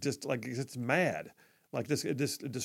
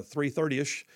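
normal talking voice

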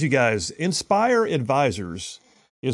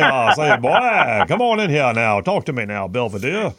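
loud, blustering Southern cartoon voice; Foghorn Leghorn; 5 dB louder than usual. Host showing off to a caller, loud Foghorn Leghorn impression. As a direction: ham it up as a booming Southern blowhard. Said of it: "my Foghorn Leghorn, uh, Kamala Harris impression".

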